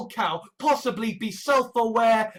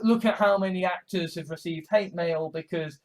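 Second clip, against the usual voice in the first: usual speaking voice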